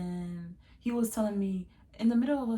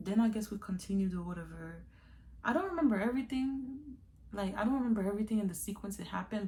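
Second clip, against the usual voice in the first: ordinary talk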